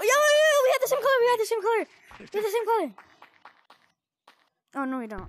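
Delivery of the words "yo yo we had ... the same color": high loud excited yelling, fading a bit; excited shouting. Real, not acted. Spotting a matching player, excited shouting. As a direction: shout it out, thrilled, calming down.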